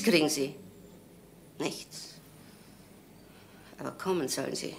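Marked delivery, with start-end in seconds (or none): 1.6-4.7 breathed out, barely audible; a private hushed "Nichts"; whispered refusal. Acted. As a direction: let the word fade to a whisper. Repeating her refusal to herself.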